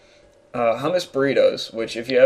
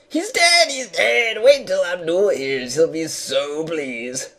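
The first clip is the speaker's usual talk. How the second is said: high squeaky glee, slowing and sinking toward the end; gleeful genie; 6 semitones higher, slower than usual. Acted. Genie gloating over dead prince, high gleeful voice. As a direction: crow with delight in a high character voice.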